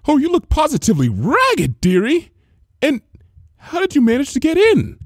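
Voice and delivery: high, loud motherly welcome; cheery greeting. A narrator's reading. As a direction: call out brightly as a baker. Missus Cake voiced.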